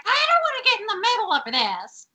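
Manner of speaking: very high, hammed-up protest; mock whiny reluctance. [name] trying to sneak out, high mock-reluctant whine.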